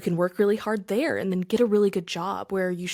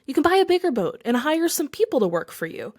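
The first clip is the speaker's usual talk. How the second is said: businessman losing patience, pitched up; irritated businessman; 7 semitones higher, 4 dB louder than usual. Acted. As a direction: push the fisherman, voice rising in annoyance. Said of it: "beginning to get a little irritated".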